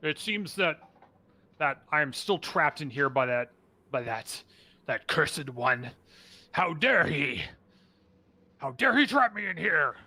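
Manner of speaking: lightly voiced hulking beast, mild indignation building; halting thanks rising into lightly acted outrage; hulking creature. Trapped creature rages at its captor, mildly indignant. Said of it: "hulking, almost gorilla-like figure".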